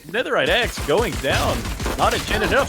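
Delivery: excited play-by-play. Real, not acted. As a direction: yell the kill call out. Calls a kill during the play-by-play.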